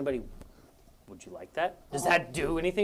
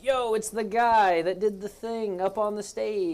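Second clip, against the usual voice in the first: hyped-up fan, slow and higher; excited vendor; 6 semitones higher, slower than usual. Retold or imitated. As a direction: call it out like a starstruck vendor. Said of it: "vendors being like".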